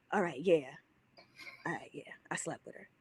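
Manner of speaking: whispered, guilty confession; sheepish whisper. Retold or imitated. Cheating boyfriend caught, admitting it in a whisper. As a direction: barely breathe out the admission.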